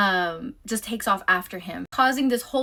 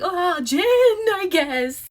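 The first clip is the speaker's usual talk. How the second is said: much higher, louder, nervous squeak; mock-nervous high squeak choosing gin; flustered high voice; 10 semitones higher, 6 dB louder, much slower than usual. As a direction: blurt the order like a panicked amateur. Flustered agent ordering drink.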